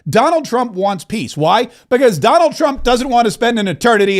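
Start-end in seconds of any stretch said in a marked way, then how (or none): none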